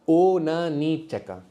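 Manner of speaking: slow, spread-out syllables; slow model answer. Shown as a demonstration. Teacher modelling the answer for students, slowly. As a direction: sound the word out for learners.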